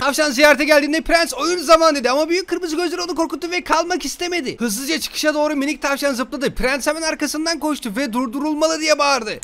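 lightly voiced storybook narration; reading to a child. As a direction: read the bedtime tale aloud.

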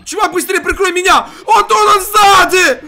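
cover me shout. Real, not acted. Teammate in firefight calls for cover.

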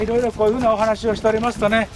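usual speaking voice

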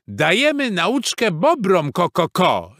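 high, loud, excited hen voice; clucking hens. A narrator's reading. Hens explaining the fight to the horse, clucking. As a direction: cluck it out proudly. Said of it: "zagdakały kury".

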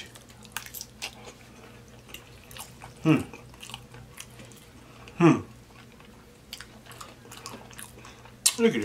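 talking between bites; chewing. Real, not acted. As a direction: mouth full of food. Seen 0: bites forkful at 0.9, then chews and hums through the clip.

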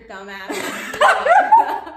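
loud, slow, breaking into laughter; laughing. Real, not acted. Blaming her friend for the fit, laughing loudly. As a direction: tease your friend as you crack up.

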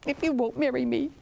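lightly whimpered threat to cry; a touch of put-on weepiness; mock-tearful snake. Refused snake warns the girl, lightly mock-tearful. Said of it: "And he cried and he cried".

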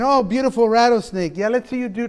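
ordinary talk

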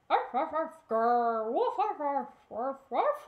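loud barking with a growl; loud arf arf with a grr; loud dog barks and growl. Acted. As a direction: bark and growl like a happy puppy. Puppy barking in snow.

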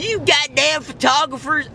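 high-pitched southern grumbler; mock an irate southern local; southern accent. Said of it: "everybody has a southern accent".

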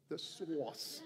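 laughing Boston accent on source, no r sound. Shown as a demonstration. Preacher playfully repeats the word in Boston accent. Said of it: "I have this Boston accent just saying".

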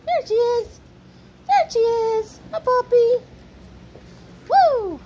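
high, loud delighted greeting; excited puppy talk. Real, not acted. Owner greeting her puppy, high and loud. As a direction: gush over the puppy.

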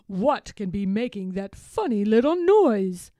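a hint of a puzzled storybook hero; wondering Babar. A narrator's reading. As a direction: wonder aloud with emphasis. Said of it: "wonders Babar".

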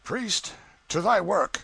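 high, loud order from a tyrant; brutal command. Acted. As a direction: order the priest to it imperiously. Brutal count orders forced wedding.

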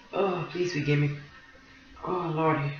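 soft, teary apology; quiet after weeping; crying softly. Real, not acted. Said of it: "Crying like a baby".

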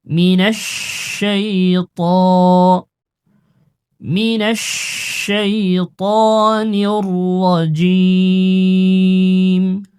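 slow melodic Quranic recitation. Shown as a demonstration. Teacher reciting to students, slow melodic chant. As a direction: draw each word out in tajweed chant.

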